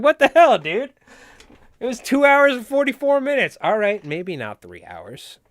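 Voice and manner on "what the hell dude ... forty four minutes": loud, high, exaggerated outrage; hammed-up exasperated retort; mock indignant outburst. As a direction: protest loudly in mock disbelief. Acted indignant reaction to a comment.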